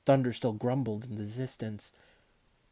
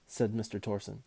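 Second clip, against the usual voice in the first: ordinary delivery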